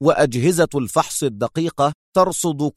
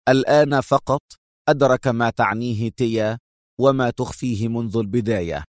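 plain reading voice

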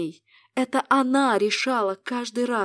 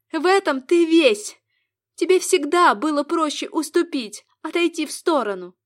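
reproachful woman; 6 semitones higher, 7 dB louder than usual. Acted.

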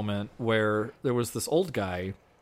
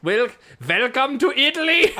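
high, hammed-up German accent; mock German accent; 10 semitones higher, 4 dB louder than usual. Welcoming visitors to Italy, mock German accent. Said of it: "that was some kind of German".

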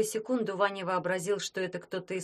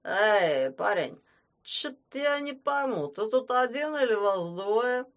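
put-on drunk man slurring, slow; 3 semitones higher, 4 dB louder, slower than usual. A narrator's reading. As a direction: slur it like a drunk. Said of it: "спросил заплетающимся языком".

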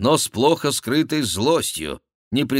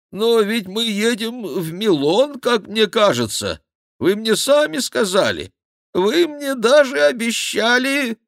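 protesting monk; 13 semitones higher than usual. A narrator's reading. (высокий протестующий голос монаха)

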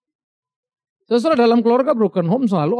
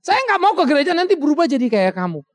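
husband's retort; 7 semitones higher, 5 dB louder than usual. Retold or imitated.

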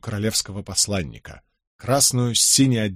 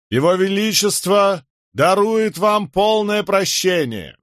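stern haughty herald; 7 semitones higher, 4 dB louder than usual. A narrator's reading. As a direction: read it down to rebels. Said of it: "Тон его был суров и высокомерен". (суровый надменный глашатай)

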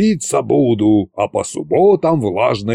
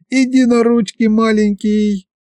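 put-on baby-talk cooing; 12 semitones higher than usual. A narrator's reading. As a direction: coo as if over a tiny puppy. Masha coos over shrunken dragon.

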